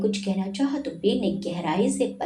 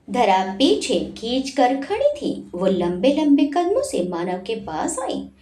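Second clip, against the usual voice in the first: ordinary delivery, nothing marked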